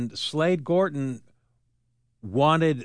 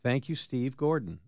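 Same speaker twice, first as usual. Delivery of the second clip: ordinary delivery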